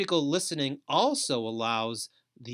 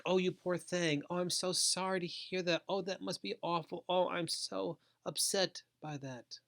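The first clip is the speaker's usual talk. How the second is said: high, soft pitying voice; mock sympathizing; 5 semitones higher, 5 dB quieter than usual. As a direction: coo with over-sweet sympathy, softly and high. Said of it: "emphatic listening is not sympathizing".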